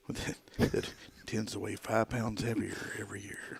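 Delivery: whispered mock meditation; hushed, teasing spa whisper; soft spa whisper. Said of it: "one of those meditation episodes".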